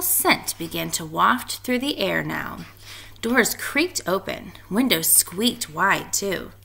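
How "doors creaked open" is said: plain reading voice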